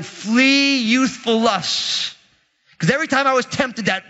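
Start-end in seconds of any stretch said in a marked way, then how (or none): 0.0-2.1 drawn-out, deliberate verse; slow recitation. Retold or imitated. Young believer recalling a verse against temptation, slow and drawn out. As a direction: stretch each word of the verse. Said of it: "I remember memorizing".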